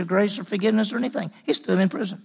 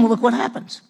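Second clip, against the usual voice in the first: ordinary talk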